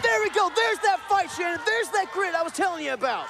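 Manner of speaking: high, loud, fired-up call; excited shout. Real, not acted. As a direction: cheer it out as he breaks free. Wrestler reaches the rope.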